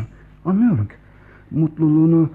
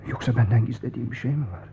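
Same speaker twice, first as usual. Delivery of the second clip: normal talking voice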